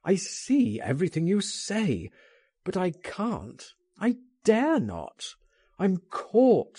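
high, anguished protest; groaning protest. A narrator's reading. Oscar tells Frank he is trapped, groaning in protest. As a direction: plead that there is no way out. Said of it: "he groaned".

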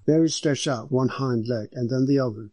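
ordinary delivery

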